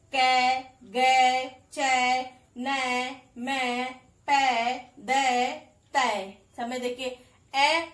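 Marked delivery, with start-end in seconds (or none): 0.1-6.4 कै, गै very slow and louder, the ai long; slow sound demo. Shown as a demonstration. Teacher reading the ऐ chart for homework, slow and loud. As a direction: draw out each ऐ syllable slowly for copying. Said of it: "मैंने आपको बता दिया कैसे पढ़ा जाएगा".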